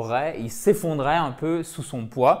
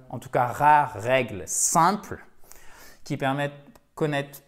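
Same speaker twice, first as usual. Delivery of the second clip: normal talking voice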